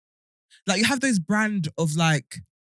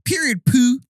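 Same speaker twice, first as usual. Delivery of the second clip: higher-pitched American catchphrase; mock American slang; American accent; 4 semitones higher, 6 dB louder, slower than usual. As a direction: put on a sassy American accent. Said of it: "we say American words".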